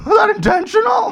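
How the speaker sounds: high shouted question; high excited shout. Pretend. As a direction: shout it high like a hyped host. Mock talk show host grilling.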